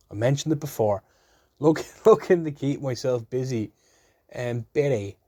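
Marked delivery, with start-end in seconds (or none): none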